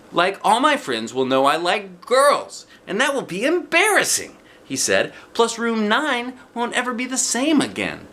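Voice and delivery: put-on high, loud fretting boy's voice; a boy mortified at the thought of his friends knowing; embarrassed boy. A narrator's reading. Jim frets to Junie B. that everyone will know he likes girls.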